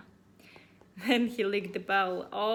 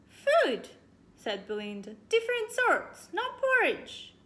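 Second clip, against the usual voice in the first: put-on high girl voice; 10 semitones higher, 6 dB louder than usual.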